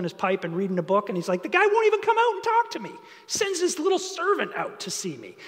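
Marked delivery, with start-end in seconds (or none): 1.4-5.4 high-pitched, rushed acted indignation; indignant disbelief. Naaman complains that Elisha won't come out to him, acted indignation. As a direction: complain in a much higher voice, hammed-up. Preacher voices slighted Naaman.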